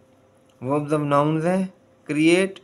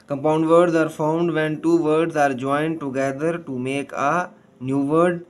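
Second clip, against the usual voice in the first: slow reading; much slower than usual. Shown as a demonstration. Teacher reads definition slowly.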